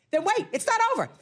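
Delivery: normal talking voice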